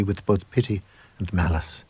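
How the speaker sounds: usual speaking voice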